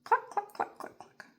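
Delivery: high clucks trailing to a breathy cluck; chicken clucking. Shown as a demonstration. Teacher voicing the chicken for kids, clucking. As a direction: cluck like a hen. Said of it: "It says".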